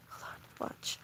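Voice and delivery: hushed whisper so the dog stays asleep. Real, not acted. Whispering to her partner beside the sleeping dog, hushed. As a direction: breathe the words, no voice. Quietly pointing out dreaming dog.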